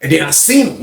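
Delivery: ordinary talk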